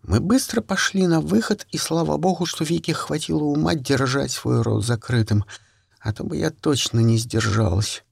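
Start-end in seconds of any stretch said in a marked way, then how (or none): none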